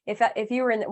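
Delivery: ordinary delivery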